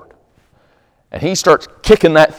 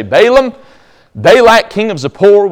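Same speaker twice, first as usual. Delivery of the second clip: lightly acted herald's call, higher pitch; envoys' proclamation; 8 semitones higher, 5 dB louder than usual. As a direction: call it out like a royal messenger. Servants formally address the prophet.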